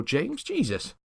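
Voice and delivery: hurt disbelief at the rudeness; indignant exclamation. Acted. Greg reproaching James after the slap, stunned.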